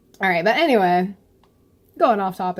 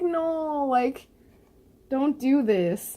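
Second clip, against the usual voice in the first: pleading; 7 semitones higher, 3 dB quieter, much slower than usual. Acted. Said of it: "I'm like".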